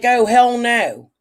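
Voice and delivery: slow, loud mock refusal. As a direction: drag out a loud no way. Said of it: "he was like".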